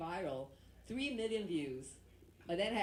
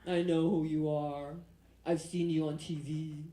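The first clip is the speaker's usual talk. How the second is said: lower, louder, slow cop voice; police officer; 3 semitones lower, 3 dB louder, slower than usual. Retold or imitated. Cop who pulled her over recognises her, low slow voice. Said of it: "And he said".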